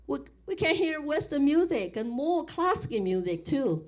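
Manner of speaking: ordinary talk, nothing marked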